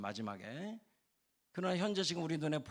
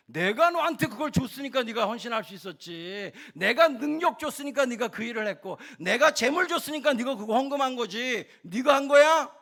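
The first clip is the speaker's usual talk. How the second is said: raised, high, forceful rebuke; 꾸짖는 하나님; 7 semitones higher, 11 dB louder than usual. Acted. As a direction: hammer each point as God setting someone straight. 하나님이 꾸짖는 말을 연기. (높고 큰 소리로 몰아붙이는 꾸짖음)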